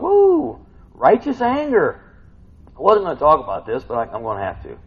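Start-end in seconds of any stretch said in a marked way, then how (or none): 0.0-2.0 high, loud, slow righteous anger with a whoop; stretched, exclaimed righteous anger; excited emphasis. Shown as a demonstration. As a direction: relish the words slowly and loudly. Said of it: "We got righteous anger going on".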